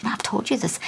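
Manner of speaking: breathy whispered thought, barely voiced; frustrated whisper. Mother whispering to herself, frustrated at her kids. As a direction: hiss it under your breath at the kids. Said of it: "I thought".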